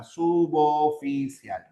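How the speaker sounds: "suboficial" drawn out slowly as su-bo-fi-cial; correct syllable separation. Shown as a demonstration. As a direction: slowly sound out "suboficial", b opening the second syllable. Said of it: "Esa sería la separación correcta". ("suboficial" lento, silabeado su-bo-fi-cial)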